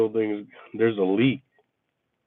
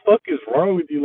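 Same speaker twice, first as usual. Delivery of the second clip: livid rant; 7 semitones higher, 3 dB louder, faster than usual. Retold or imitated. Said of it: "I was livid".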